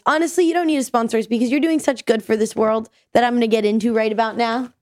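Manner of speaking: usual speaking voice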